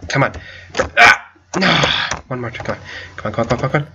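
normal talking voice

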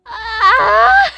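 high gasp into a loud yelp; piercing high gasp and yell; shrieking gasp and cry. Real, not acted. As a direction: gasp then cry out in shock. Shock at result.

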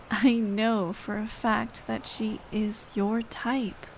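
normal talking voice

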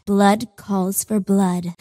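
normal talking voice

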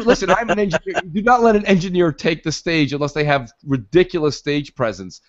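ordinary delivery

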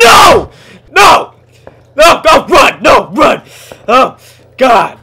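loud, high terrified shouts; frantic loud cries to run; panicked yelling. Real, not acted. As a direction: scream at yourself to flee. Monster chasing him in game.

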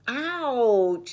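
loud, high drawn-out yelp; acted storybook ouch; cry of pain. A narrator's reading. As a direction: yelp as if just bumped hard. Arthur runs into a tree.

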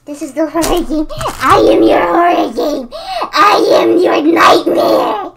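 booming villain roar, rising to a shout. Acted. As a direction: bellow it like a horror game monster. Villain voice for horror game.

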